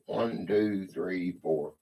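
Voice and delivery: low, soft counting under the breath; counting to oneself. Real, not acted. Host tallies to self between quiz questions, quiet low counting. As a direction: count privately, dropped well below talking voice.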